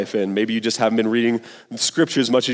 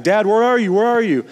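higher, louder frantic call for dad; lion cub crying for his father, higher and louder; panicked cub calling; 10 semitones higher, 4 dB louder than usual. Retold or imitated. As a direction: call out like a scared child lost in the stampede. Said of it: "He's crying for his dad".

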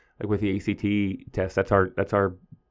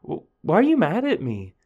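higher-pitched retold student protest; mock-indignant, higher-pitched question; blurted out; 7 semitones higher, 4 dB louder than usual. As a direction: blurt it out, imitating an affronted student. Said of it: "then just blurted out".

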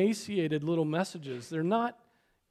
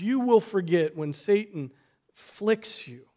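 usual speaking voice

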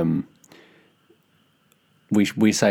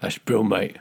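Cockney accent; slower than usual. Shown as a demonstration. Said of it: "the Cockney accent".

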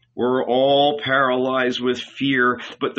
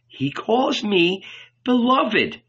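high, slow, savored boast; boastful; 10 semitones higher, slower than usual. A narrator's reading. John outdoing the other disciples, boastful. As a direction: linger proudly over it, pitched up.

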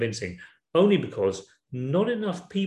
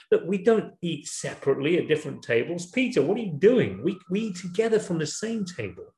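higher-pitched, exasperated appeal; acted remonstrance; 5 semitones higher than usual. Paul confronting Peter over separate tables, acted remonstrance. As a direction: take him to task in a raised pitch.

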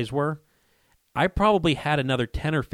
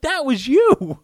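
much higher and louder, thrilled; excited realization; 7 dB louder, slower than usual. Retold or imitated. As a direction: blurt it out in amazement. Realizing dad grazed this buck.

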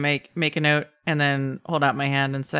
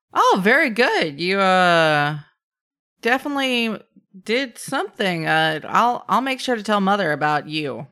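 in character; 5 semitones higher, 3 dB louder than usual. Acted. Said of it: "I look up and I say".

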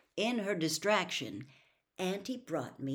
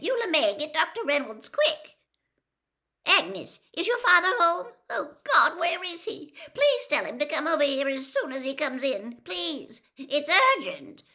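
much higher and louder, panicked pleading; frantic aunt; 11 semitones higher, 9 dB louder than usual. A narrator's reading. Aunt phones for the doctor for her hurt nephew, frantic and high. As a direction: beg down the phone line in a panic.

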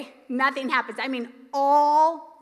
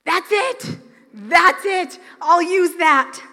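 higher, eager, a bit louder; excited discovery; 3 semitones higher, 5 dB louder, slower than usual. Acted. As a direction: seize on the sign with rising excitement. Elijah spotting the small cloud.

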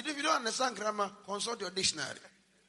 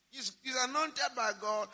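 usual speaking voice

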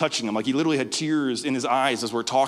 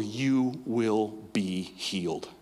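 slow, much softer, awed; hushed revelation; 5 semitones lower, 6 dB quieter, much slower than usual. Retold or imitated. Ren reading the verse off the page, slow hushed awe. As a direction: breathe it like a holy word.

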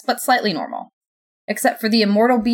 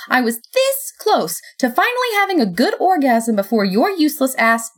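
higher, heated complaint; angry outburst; 5 semitones higher than usual. A narrator's reading. Narrator berates Death in front of God, heated. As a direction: let the grievance spill out, emphatically.

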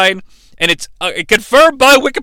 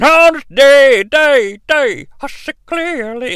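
echoing slow voice; 4 semitones higher, slower than usual. Retold or imitated. Quoting Edge's entrance echo.

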